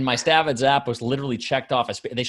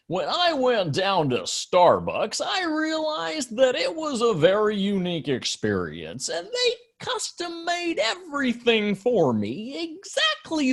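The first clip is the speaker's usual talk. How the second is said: much higher, slow sing-song cadence; monotone audiobook rhythm; 11 semitones higher, slower than usual. Retold or imitated. Mocking an audiobook speaker's predictable sing-song rhythm. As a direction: imitate a droning audiobook narrator, same lilt every line. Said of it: "it was literally just this, this rhythm of".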